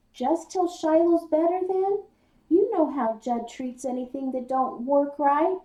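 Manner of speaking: high, loud urgent plea; pleading. Acted. Boy begging his father to keep the dog, high and urgent. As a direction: beg him before he walks off.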